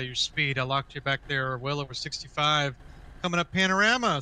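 ordinary talk, nothing marked

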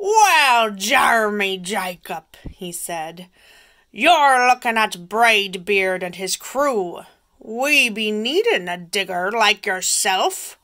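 loud, gruff-voiced pirate captain. Braidbeard voiced in story.

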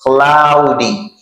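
teacher models the word; slow model word. Shown as a demonstration. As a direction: say "cloudy" slowly, clearly, and repeat it. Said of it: "I say cloudy".

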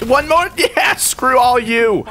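high, drawn-out victory yell; elated, defiant gamer cry; triumphant outburst. Real, not acted. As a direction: crow it at the losers. Wins against the shotgun users.

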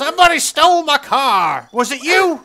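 high, loud indignant cry; mock outrage. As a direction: play it up as a wronged farmer. Finds his car stolen.

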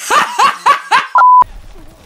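high, loud cackling burst; loud laugh. Real, not acted. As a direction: burst out laughing at your own joke. Laughs at own innuendo joke.

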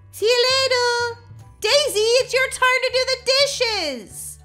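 loud, high call across the house; exasperated yell. Acted. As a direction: raise your voice at someone shirking chores. Mom calls out, exasperated at Daisy.

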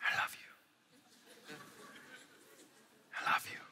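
hushed lover's whisper; very soft, voiceless murmur of love. Acted. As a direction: breathe it softly to a sweetheart close by. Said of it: "Lovers whisper".

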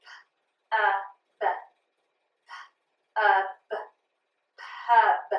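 pub built sound by sound, p then uh then b, then blended. Shown as a demonstration. Teacher to class, blending sounds of pub. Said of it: "So I'm going to try to pronounce it".

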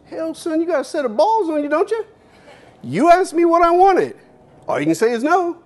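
high, loud, fast Texas boss, impressed. Retold or imitated. Owner admires the kid's nerve, high loud country voice. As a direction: bluster like an old Texas boss tickled by a bold kid. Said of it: "He goes".

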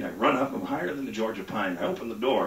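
ordinary delivery

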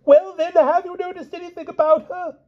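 put-on very high, piping old king voice; fussy old king. A narrator's reading. Pellinore questioning the knights, high and piping. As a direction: pipe it like a dotty old monarch.

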